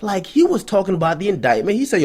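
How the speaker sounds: usual speaking voice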